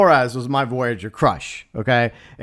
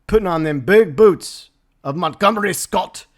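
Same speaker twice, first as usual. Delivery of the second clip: a hammed-up Scottish brogue, higher and louder; bad fake Scottish accent; 4 semitones higher, 4 dB louder than usual. Fan mocking the new Scotty in a fake Scottish accent. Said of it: "that was a terrible fake accent".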